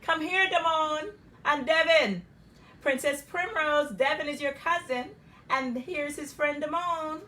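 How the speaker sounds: put-on loud, high cheerful call to the boys. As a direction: call them over across the yard. Uncle calling the boys over.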